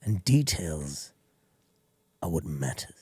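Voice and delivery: slow, hushed English villain, dropping to a whisper; English villain accent. Pretend. A film villain lecturing Mister Bronzy in a hushed English accent. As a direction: purr it like a menacing English villain. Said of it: "I want either an English accent where it's like".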